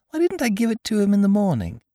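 put-on high-pitched little boy's question; puzzled child. A narrator's reading. Christopher Robin asking about the morning, puzzled. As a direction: wonder aloud in a high child voice.

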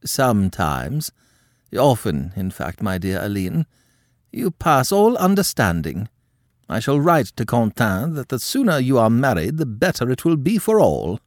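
a scandalised French countess lecturing her niece; haughty old countess. A narrator's reading. Madame scolds her niece.

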